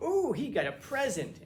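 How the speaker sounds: imitating a thrilled secretary opening mail; mock excited secretary. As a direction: coo over a present like a delighted secretary. Mock secretary excited over package.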